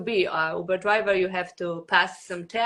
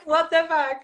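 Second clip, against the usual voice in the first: incredulous; 7 semitones higher than usual. Retold or imitated. Retold disbelief at the test.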